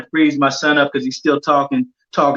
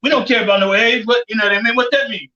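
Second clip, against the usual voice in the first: higher-pitched dismissive retort; lightly mocked, raised-pitch challenge; Floyd; 5 semitones higher than usual. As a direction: imitate a trainer brushing it off. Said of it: "Floyd turned around".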